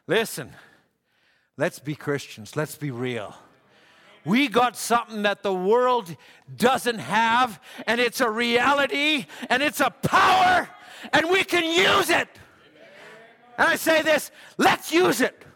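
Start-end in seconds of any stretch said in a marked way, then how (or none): 4.2-15.4 preaching at full force. Acted. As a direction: drive it home to the congregation, louder each line. Builds to preaching climax.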